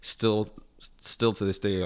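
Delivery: ordinary talk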